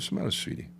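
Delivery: high, soft coaxing question; tender concern. Retold or imitated. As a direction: gently ask a worried child. Gently asking a concerned young girl.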